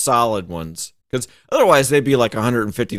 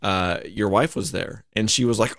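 normal talking voice

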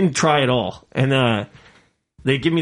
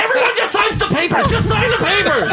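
mad hiring lady. Retold or imitated. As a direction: shriek like the furious manager. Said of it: "she was so mad".

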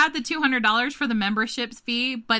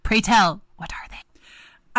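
slow, theatrical whisper; mysterious whisper; 4 dB louder, much slower than usual. Pretend. Host asking for urban legends, playfully spooky whisper. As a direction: whisper it like a campfire storyteller.

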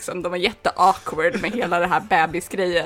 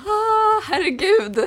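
high breathy gasp of emotion; moved exclamation; overwhelmed gasp; 14 semitones higher, 4 dB louder, slower than usual. Acted. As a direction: let it spill out, shaken. Hailey comes out moved.

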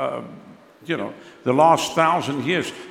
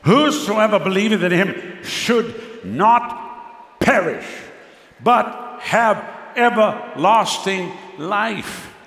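slow scripture reading; 3 semitones higher, much slower than usual. Acted.

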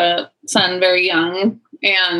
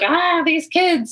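put-on drawn-out, higher, tired "ah, these kids"; weary parent sigh; 8 semitones higher, slower than usual. Other parents groaning about their kids, drawn out and weary. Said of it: "but who are like".

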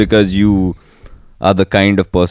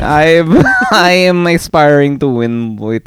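higher mock Filipino coworker; Filipino accent; 7 semitones higher than usual. Coworker explaining his Ferrari gear, high Filipino accent. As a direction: quote him in a put-on Filipino accent. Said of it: "I had a Filipino coworker".

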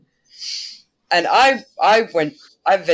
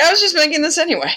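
much higher and fast, giggly and delighted; gushing like a thrilled little helper; eager Loki; 11 semitones higher, much faster than usual. Retold or imitated. Loki answers the gods about his dress, giddy high and fast. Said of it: "He's like".

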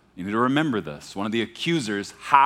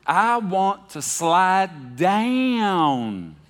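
put-on high, very slow Southern toddler drawl; thick Texas drawl; 7 semitones higher, 3 dB louder, much slower than usual. Preacher mimicking his childhood video, exaggerated Texas drawl. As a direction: imitate a small Texan kid, dragging out DOWN. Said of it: "talks like that".

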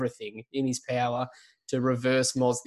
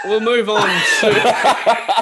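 laughing; 9 semitones higher, 10 dB louder, much slower than usual. Real, not acted. Laughing through segment transition.

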